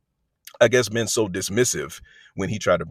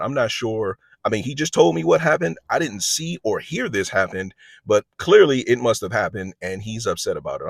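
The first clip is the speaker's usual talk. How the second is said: ordinary delivery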